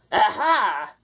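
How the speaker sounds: gleeful pirate shriek; triumphant shriek. Acted. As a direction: crow it in triumph. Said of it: "shrieked Blackbeard".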